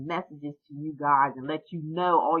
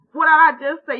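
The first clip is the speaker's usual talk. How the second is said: suddenly loud and high, catching herself; startled self-correction; 10 semitones higher, 11 dB louder, much faster than usual. Real, not acted. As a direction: stop short, surprised at your own slip. Catches herself mid-sentence.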